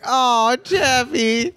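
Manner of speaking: high adoring coo. Retold or imitated. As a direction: coo it like to a puppy. Cooing at the cute robot.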